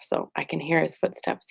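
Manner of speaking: ordinary talk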